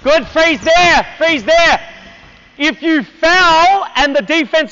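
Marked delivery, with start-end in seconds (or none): 0.0-1.8 raised, higher coaching call; drawn-out instruction to players mid-drill. Real, not acted. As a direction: call a halt across the court.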